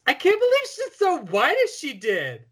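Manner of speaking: put-on high-pitched straight Valley Girl squeal; Meg. Host mocking the actress's slipping accent, high Valley Girl shout. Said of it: "Meg shouts".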